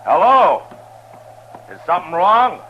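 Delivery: high, loud call to someone far away. Acted. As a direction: raise your voice so she hears you. Calling louder, no answer.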